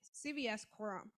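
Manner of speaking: ordinary talk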